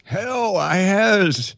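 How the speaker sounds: high old man's voice, put on; wizened old Ayaz. Guest playing the host's future self greets him as an old man. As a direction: croak it out like an ancient version of him. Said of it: "I imagined a wizened old Ayaz with a stick".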